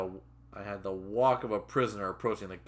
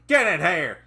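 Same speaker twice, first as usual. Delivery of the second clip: loud, raised command; angry shouted order; 7 semitones higher, 10 dB louder than usual. Acted. Trainer grabbing his trainee's arm, shouted order. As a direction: shout them inside.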